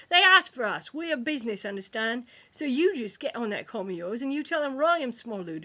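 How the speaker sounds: loud, hard-edged threat; fierce kidnapper. A narrator's reading. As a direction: lean in and threaten the clerk. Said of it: "The kidnapper grinned fiercely".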